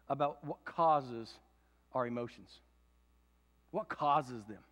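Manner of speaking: ordinary talk, nothing marked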